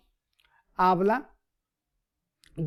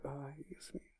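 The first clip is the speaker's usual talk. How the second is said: much softer, sighing "ay, Dios mío"; weary sigh; 4 semitones lower, 22 dB quieter than usual. Retold or imitated. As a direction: breathe it out like a headache prayer. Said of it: "la oración de dolor de cabeza".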